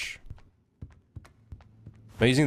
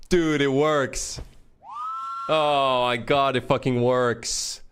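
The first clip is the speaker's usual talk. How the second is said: giddy relief; 3 semitones higher, much slower than usual. Real, not acted. Savoring the success.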